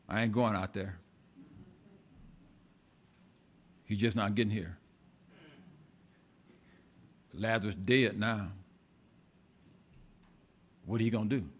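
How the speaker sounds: low, soft, withdrawn grumble; a sulking, grieving sister; sullen grief. Acted. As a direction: speak low like she has given up. Mary refusing to go out.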